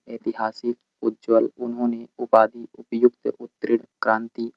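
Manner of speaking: usual speaking voice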